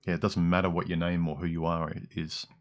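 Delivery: ordinary talk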